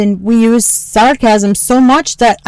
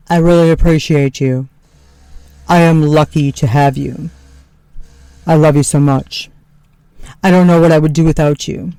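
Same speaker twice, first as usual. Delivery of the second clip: lower, earnest husband voice; imitating husband; 6 semitones lower than usual. Wife mimicking husband Tony thanking her, lower sincere voice. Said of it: "said things to me like".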